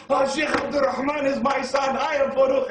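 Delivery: loud, high ecstatic shout; joyful screaming. Acted. Father screaming to the city that the sheikh is his son, loud and high. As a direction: scream it with joy to the whole street. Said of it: "He comes out the house screaming".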